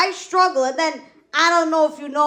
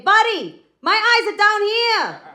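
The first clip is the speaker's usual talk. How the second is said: higher-pitched mock protest, last words drawn out; indignant call-out; 9 semitones higher, 5 dB louder, slower than usual. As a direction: call up to a tall guy in mock outrage. Comic acts out confronting a tall date.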